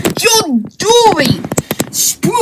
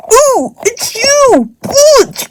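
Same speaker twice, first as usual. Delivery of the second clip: pitched up and slow, a lightly voiced troll; lightly higher character greeting; high puppet voice; 6 semitones higher, slower than usual. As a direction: stretch it out in a higher figure voice. Another figure greets Branch.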